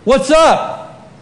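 high, loud mock questions; acted jeering call-out; taunting yell. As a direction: heckle rivals across a distance. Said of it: "he yells out to him".

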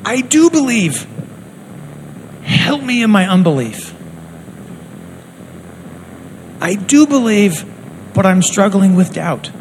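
a father pleading for his son; desperate father crying out. Retold or imitated. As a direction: beg Jesus, voice lifted high. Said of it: "the father cries out".

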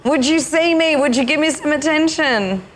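high desperate plea for attention; pleading feminine voice. Acted. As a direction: cry out to be seen. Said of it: "your feminine is sitting there going".

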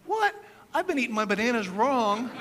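jumps way up high and loud on What; imitating his stunned self at the monkey video; shocked realisation. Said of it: "I was like".

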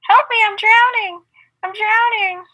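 high, loud mock cries for help; drowning. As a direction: yell like you're going under. Acting out pool chicken drowning.

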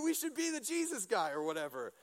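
ordinary talk

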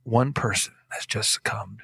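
hushed, mock-grave newsreader dropping to a breath; near-whispered solemn news line; fake sentimental anchor. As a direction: somber fake-sympathy announcement. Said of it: "The news guy goes".

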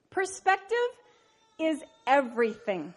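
usual speaking voice

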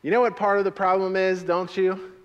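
high, loud, quick recital; loud call and response. Shown as a demonstration. Pastor leading the congregation in repeating the line, loud. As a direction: lead the congregation in saying it. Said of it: "You can say it with me".

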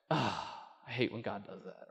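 a sulky aside, almost to yourself; grudging grumble. Retold or imitated. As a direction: mutter it under your breath. Grumbling after God's correction.

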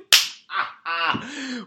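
real loud cackle; a sudden big laugh at the absurd answer; loud burst of laughter. Real, not acted.

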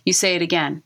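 normal talking voice

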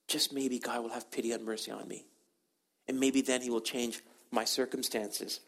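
soft, low, subdued plea; quietly hope for mercy; humble hope. Acted. David on the mountain hoping God pities him, humbly.

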